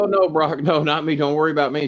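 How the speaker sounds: high-pitched retold backpedal; placating. Retold placating reply in confrontation.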